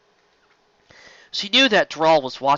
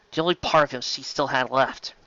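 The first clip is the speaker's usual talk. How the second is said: ordinary delivery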